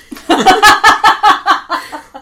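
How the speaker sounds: loud, high-pitched laughing; real burst of high laughter; big laugh. Real, not acted. Co-host cracking up at hot take, big laugh.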